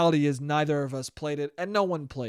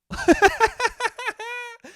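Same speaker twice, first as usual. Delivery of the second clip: high laugh. Real, not acted. Laughing at the game logic.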